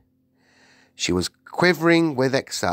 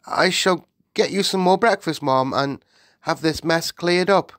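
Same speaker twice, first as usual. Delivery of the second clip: higher, deferential servant voice; flustered maid; 8 semitones higher, 4 dB louder than usual. Acted. The maid offers the Queen more breakfast, flustered. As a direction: offer help nervously.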